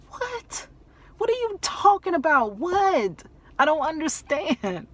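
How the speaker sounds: very high, distraught confusion; retold panic, voice leaping high; shocked disbelief. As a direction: cry out in bewilderment, pitch shooting up. Retold shock at bad news.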